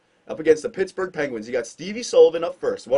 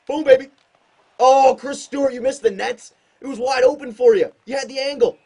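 excited; 8 semitones higher, 5 dB louder than usual. Real, not acted. Excited then groaning at miss.

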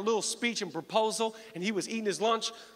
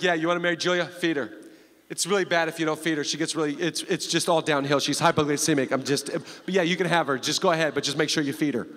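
Bob's voice; 6 semitones lower than usual. Retold or imitated. Father-in-law answering the marriage proposal, low and deadpan. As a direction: drop way down and ask it flat. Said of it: "He's like".

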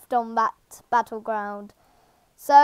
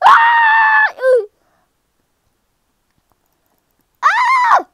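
very high, loud shrieks; panicked screaming; 5 dB louder, much slower than usual. Real, not acted. Player under attack in the game, shrieking high.

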